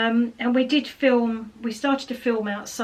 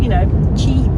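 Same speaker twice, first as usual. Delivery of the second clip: ordinary talk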